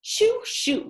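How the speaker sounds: high, fussy shooing; shooing hens. A narrator's reading. Chickens shooing the pigs off the fence, high and fussy. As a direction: hens hurrying the pigs away. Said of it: "said the chickens to the pigs".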